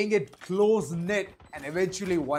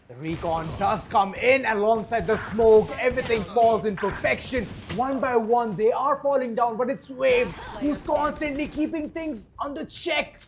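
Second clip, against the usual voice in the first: higher, louder caster climbing to a peak; urgent esports commentary, rising steadily; excited play-by-play; 6 semitones higher, 7 dB louder than usual. Real, not acted. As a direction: build the hype as players fall. Live play-by-play climax.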